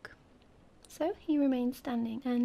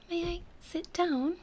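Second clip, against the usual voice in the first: slow, high, timid little prince; 6 semitones higher than usual. A narrator's reading. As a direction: ask permission shyly, in a raised voice. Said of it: "asked the prince timidly".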